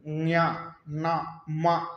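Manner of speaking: न्या ना मा said one at a time, each nasal kept distinct; nasal examples. Shown as a demonstration. Teacher contrasting the nasals for the pupils. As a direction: separate न्या, ना, मा clearly. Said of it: "सब एक नहीं है".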